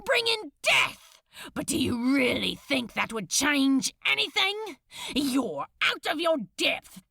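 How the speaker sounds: words pouring out in rage; ranting fury. Acted. Crowley ranting he cannot stop, furious shout.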